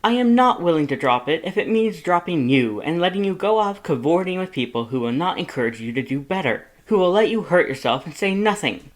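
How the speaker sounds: high, loud, exasperated lecture; worried brother laying down the law; Papyrus scolding. A narrator's reading. Papyrus lectures Sans about drinking, high and loud.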